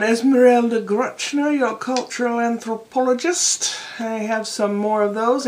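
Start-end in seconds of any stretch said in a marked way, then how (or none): 0.0-3.8 Esmeralda Gruchner. Acted. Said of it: "done a few accents over the years".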